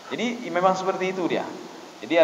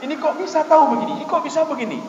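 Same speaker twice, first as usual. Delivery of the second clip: higher, quick astonished voice; mock amazement, pitched up; amazed Arabs; 8 semitones higher than usual.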